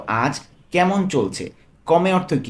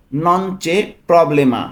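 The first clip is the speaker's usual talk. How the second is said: slow careful model; 4 dB louder, slower than usual. Shown as a demonstration. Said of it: "teacher models Italian phrase".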